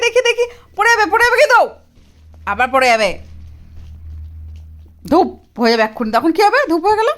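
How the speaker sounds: very high, loud teasing exclaims; playful amazement. Real, not acted. Mother teasing laughing toddler. (হাসতে থাকা বাচ্চাকে আদুরে মজার খুনসুটি)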